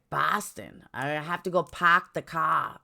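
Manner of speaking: mock Bostonite, r's dropped; exaggerated Boston vowels on a put-on line; parody Boston accent. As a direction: do a horrible true-Bostonite accent. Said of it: "parody of a true Bostonite".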